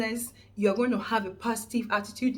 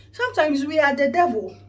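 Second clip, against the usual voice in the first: louder punchline; 4 semitones higher, 7 dB louder than usual. Real, not acted. Punchline about being the devil.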